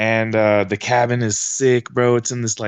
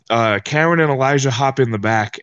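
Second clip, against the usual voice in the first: usual speaking voice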